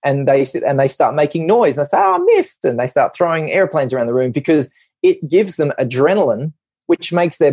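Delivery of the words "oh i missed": mischievous schoolkid. Retold or imitated. Voices noisy restless school kids.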